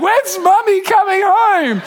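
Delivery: high, slow wailing question; whiny crying plea. Retold or imitated. Dad and boys wail for mum, high and slow.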